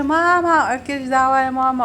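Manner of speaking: high, loud imitation of a child answering back; cheeky daughter. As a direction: mimic a little girl brushing mum off. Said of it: "تقول لي".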